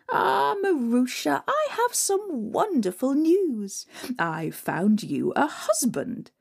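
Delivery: high, slow, sly sweetness; scheming stepmother. Acted. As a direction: savour the cruel trick.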